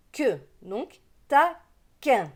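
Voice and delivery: "que, donc taquin" slowly, qu as a hard k; slow down and model "taquin" with a hard k; slow model word. Shown as a demonstration. Teacher showing children how to say taquin, slowly. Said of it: "le Q U se prononce".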